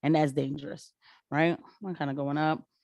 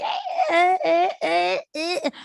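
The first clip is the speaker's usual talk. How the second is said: high laughing hype yelps; imitating a hype yelp; 12 semitones higher, 3 dB louder, much slower than usual. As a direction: mimic the girl's excited yelping.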